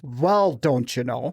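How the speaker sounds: drawn-out Minnesotan vowels; exaggerated slow Minnesota accent. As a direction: stretch it slowly like a Minnesotan. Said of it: "you don't have to make an accent".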